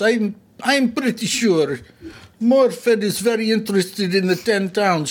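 put-on light Russian accent. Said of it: "Russian accent for Morfid".

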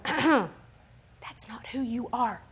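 slow, breath-only whisper; Holy Spirit's prompting. Acted. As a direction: whisper it as a gentle inner correction. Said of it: "Holy Spirit's tapping on the inside of me".

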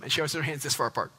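ordinary delivery

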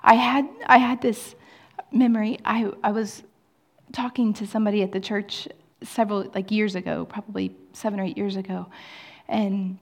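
usual speaking voice

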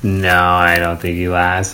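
ordinary talk, nothing marked

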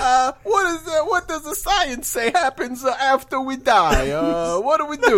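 fumbling fake Italian Pope with lots of uh; bad Italian accent. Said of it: "stereotypical bad Italian accent".

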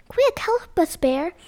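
high, loud, breathy plea for help; crying in fear. Acted. Little animals crying to Bear for help in fear. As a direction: beg for rescue, frightened. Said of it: "they cried in fear".

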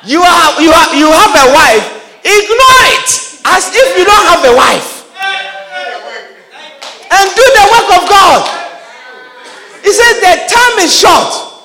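a pastor pounding home his point; fiery preaching. Acted. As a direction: preach it hard to the congregation. Preaching at full force.

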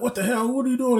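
high-pitched startled prince; Prince Charming. Retold or imitated. Prince finding a wolf in the bed, high indignant voice. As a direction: put on a high, baffled voice. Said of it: "and Charming's like".

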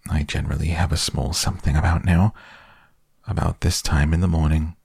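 plain reading voice

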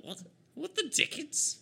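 very high, startled yelp into sputtering; outraged villain. Acted. Villain slammed into a wall by counterspell, high sputtering outrage. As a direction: squawk indignantly in a high put-on voice.

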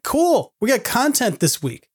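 high, sarcastic mock excitement; mock cheer. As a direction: fake upbeat cheer. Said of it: "I'm like".

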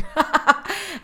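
quick high giggle; amused quick giggle; short high laugh. Real, not acted. Laughs at lipstick trick.

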